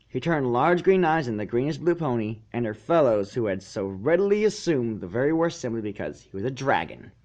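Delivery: plain reading voice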